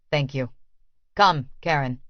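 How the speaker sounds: lightly voiced Vasily, calm and possessive; cold master. A narrator's reading.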